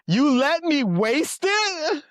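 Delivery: slow, high, incredulous fury; hammed-up indignation, slow and high; mock outrage. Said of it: "The flash of rage".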